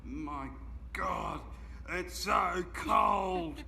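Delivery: loud slow cry about the cold; cold shout. Real, not acted. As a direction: shout it out, freezing. Exclaiming about the icy cold.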